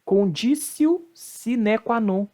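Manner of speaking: slow conditio, "condísio"; Latin T as C. Shown as a demonstration. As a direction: slowly model conditio with an S sound. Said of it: "O T com som de C".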